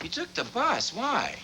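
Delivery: high, softly puzzled question; surprised questioning. Acted. Son questions his mother about the bus, surprised. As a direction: lift the pitch in mild disbelief.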